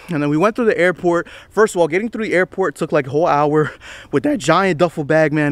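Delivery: usual speaking voice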